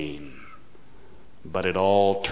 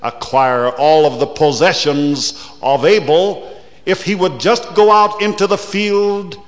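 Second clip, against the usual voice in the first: loud rising preacher's cry, higher and higher; preaching at full force; 6 semitones higher, 10 dB louder, faster than usual. Acted. Preacher builds to a climax.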